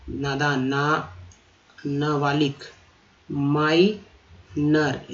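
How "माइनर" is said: माइनर stretched out slowly; very slow demonstration. Shown as a demonstration. Teacher modelling माइनर slowly for the class. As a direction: draw out माइनर syllable by syllable. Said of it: "ओ आर को भी र पढ़ा जाता है".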